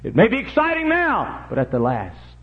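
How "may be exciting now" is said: high preaching cry; raised cry. Real, not acted. Preacher warning of passing fun, high cry. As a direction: lift it sharply as a warning.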